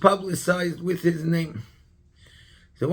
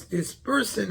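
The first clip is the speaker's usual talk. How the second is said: ordinary talk, nothing marked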